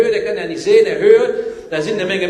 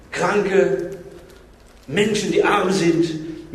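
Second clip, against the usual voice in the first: usual speaking voice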